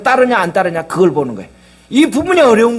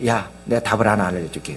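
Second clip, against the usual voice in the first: lower, softer voice of God answering; 12 semitones lower, 8 dB quieter than usual. Retold or imitated. God answering Moses's prayer, lower and softer. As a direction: speak down gently as God.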